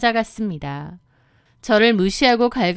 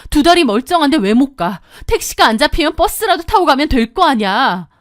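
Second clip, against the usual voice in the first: loud, heated telling-off; angry scolding; 3 semitones higher, 4 dB louder than usual. Acted. Narrator scolding sister-in-law over the phone, angry. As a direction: let the anger pour out. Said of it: "저도 완전 폭발했습니다".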